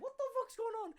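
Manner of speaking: very high, squeaky put-on alarm; mock bewilderment. Spun round in a headlock, high mock alarm. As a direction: squeal it like a comically confused victim.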